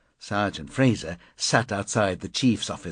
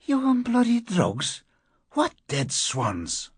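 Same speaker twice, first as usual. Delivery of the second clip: incredulous outburst; 14 semitones higher, slower than usual. Acted. Fraser incredulous at the question.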